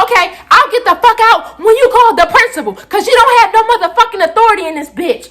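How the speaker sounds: going off. Retold or imitated. Student defying someone without authority, high angry rant. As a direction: go off on them, high and defiant. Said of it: "like, really going off".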